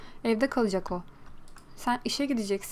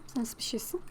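whisper; 8 dB quieter than usual. Acted. Teasing whisper to new Sim.

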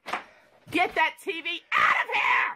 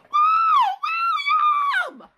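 real alarmed yell; 6 dB louder than usual. Real, not acted. Mother to son wrecking things, high screamed name.

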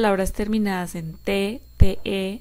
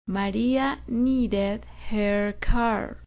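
very slow, each word spaced for repeating; slow model sentence; much slower than usual. Shown as a demonstration. Teacher dictating slowly for students to repeat. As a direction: read it slowly so students can echo every word. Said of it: "Repitan después de mí".

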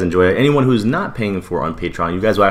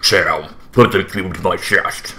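Optimus Prime; slower than usual. Retold or imitated. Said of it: "do the Sam".

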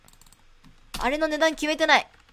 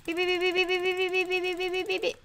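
buzzing noise; 4 semitones higher, 5 dB quieter, faster than usual. Pretend. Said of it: "楽しませるのでお待ちください".

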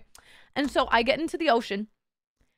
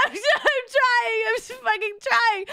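very high, stretched-out protest; squealed, exasperated retelling of struggling; mock desperate whine; 10 semitones higher, 4 dB louder, slower than usual. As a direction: plead back at the shouted order, hammed-up. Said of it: "And I'm like".

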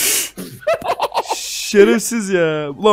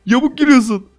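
laughing; 5 semitones higher, 3 dB louder than usual. Real, not acted. Laughing at glitching friend.

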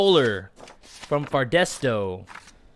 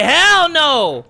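mock dad refusal; 10 semitones higher, 8 dB louder than usual. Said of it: "'cause I'll be like".

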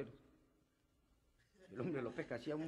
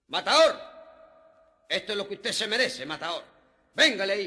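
hailing the matador; 4 semitones higher, 13 dB louder than usual. Retold or imitated. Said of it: "le dicen".

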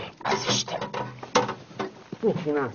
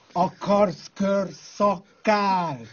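very slow, stretched out question; lazy taunting repeat of the offer; slow mocking drawl; much slower than usual. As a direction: drag every syllable teasingly. Mock offer, repeated drawn out.

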